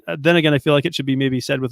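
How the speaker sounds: ordinary talk, nothing marked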